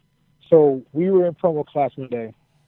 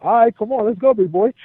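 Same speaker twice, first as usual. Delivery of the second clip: imitated rival taking up a challenge, pitched up; higher-voiced mock dare, imitating a rival; John; 8 semitones higher than usual. Cena answers the wrestler's challenge, high imitated dare. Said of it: "John goes".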